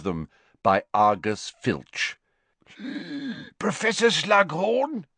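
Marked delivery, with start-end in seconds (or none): 2.8-3.5 wheezing gasp. Acted. Filch arrives wheezing. 3.6-5.1 high, wheezy caretaker; wheezing Filch. Acted. Filch dragging Malfoy to Slughorn, high and wheezing. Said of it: "wheezed Filch".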